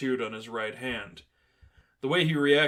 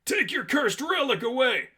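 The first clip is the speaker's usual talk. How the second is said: terrified; 12 semitones higher, 3 dB louder than usual. Acted. Thug panics at the relic.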